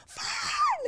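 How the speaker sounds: high, loud shrieked curse; startled scream. Retold or imitated. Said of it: "is like".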